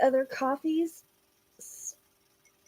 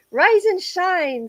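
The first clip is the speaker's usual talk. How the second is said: sing-song rise and shine; 6 semitones higher, 5 dB louder, slower than usual. Retold or imitated. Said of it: "says Nancy".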